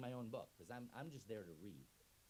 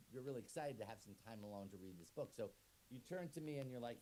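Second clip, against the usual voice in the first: normal talking voice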